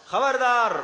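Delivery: a caller's sharp "خبردار" from on high; warning call. Acted. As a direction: shout a warning down from the wall. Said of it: "وہ آواز دے رہا ہے".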